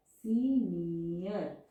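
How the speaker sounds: Senior drawn out very slowly, each vowel separate; slow, vowels split apart. Shown as a demonstration. Shorthand teacher to students, showing the two vowels, very slow. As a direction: stretch senior so both vowel sounds are heard. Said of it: "अलग-अलग हम pronounce कर रहे हैं".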